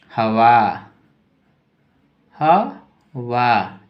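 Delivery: हवा stretched very slowly; drawn out dictation. Shown as a demonstration. Teacher dictating word slowly. (बहुत धीरे खींचकर)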